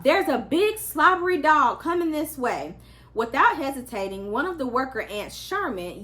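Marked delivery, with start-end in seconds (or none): 0.0-2.8 higher, louder urgent blurt; breathless blurt. A narrator's reading. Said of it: "Nearly out of breath, he blurted out".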